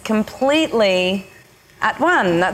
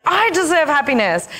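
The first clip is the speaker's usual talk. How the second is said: demanding inner feminine; 7 semitones higher than usual. Retold or imitated. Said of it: "it was just like".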